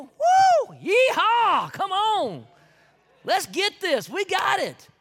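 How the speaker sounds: loud high whooping yeehaw; joyful shouting. Acted. Preacher hollering Southern joy to the crowd.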